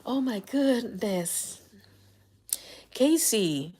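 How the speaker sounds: ordinary talk, nothing marked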